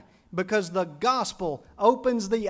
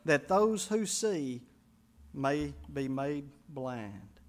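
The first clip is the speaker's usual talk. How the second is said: slow and deliberate; 5 semitones lower, much slower than usual. Acted. Slow closing line.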